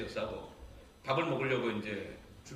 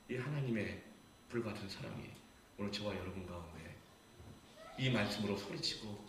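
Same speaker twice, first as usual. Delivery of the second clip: ordinary talk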